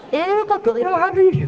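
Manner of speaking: high, slow, laughing mother blaming the frog; playfully distract the fallen child; mock mother. Mother to fallen toddler, high laughing mock voice.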